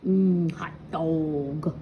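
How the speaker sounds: slow, low, drawn-out hot dog quip; slow savoring joke. Acted. Reading to kids, slow joking aside. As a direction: savor it slowly like a tasty treat.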